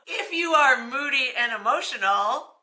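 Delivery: reading the line through a laugh; can't keep a straight face. Real, not acted. Vlogger reads the moody old wives' tale to viewers, laughing.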